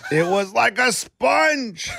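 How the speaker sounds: drawn-out quote. Retold or imitated. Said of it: "quoting the Colts guy".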